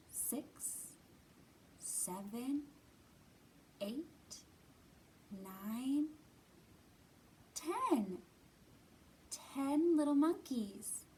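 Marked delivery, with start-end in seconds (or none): none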